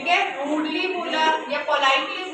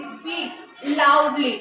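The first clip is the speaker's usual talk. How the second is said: speaks loudly drawn out very slowly, loudly stressed; slow stressed demo; 4 dB louder, much slower than usual. Shown as a demonstration. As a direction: stretch out speaks loudly so the adverb stands out. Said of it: "loudly". (loudly पर ज़ोर देकर बहुत धीरे)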